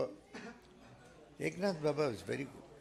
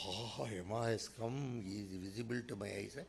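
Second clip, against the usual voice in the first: hushed awe; 5 semitones lower, 8 dB quieter than usual. Retold or imitated. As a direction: whisper your dread of death. Disciple seeing Yama, awed.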